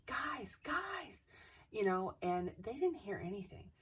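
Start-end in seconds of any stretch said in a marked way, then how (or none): 0.1-1.2 retold call for help at held-back volume; mock yell. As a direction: imitate yelling to the others. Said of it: "I started yelling".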